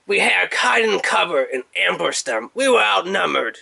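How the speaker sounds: high, hammed-up old soldier telling war stories; Kup's old war-veteran voice. Toy reviewer voicing Kup bragging to Arcee and Wallace Prime, a hammed-up high veteran voice. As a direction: boast like a grizzled veteran reliving battles. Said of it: "he'll always talk like that and tell war stories".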